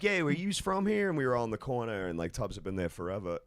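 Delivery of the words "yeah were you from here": put-on slower, high Philly accent; imitating a Philly bartender. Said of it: "she goes".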